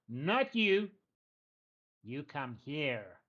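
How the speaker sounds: sharp, then slow goblin chief; goblin boss. Acted. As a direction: bark the first words, then slow down. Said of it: "she goes".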